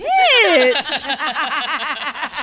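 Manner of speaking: long real laugh. Real, not acted. Friends' podcast story, long real laugh.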